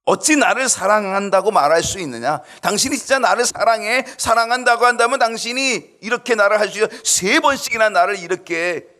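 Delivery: high, loud accusing plea; Delilah's pleading. Acted. Delilah pleading with Samson, high and accusing.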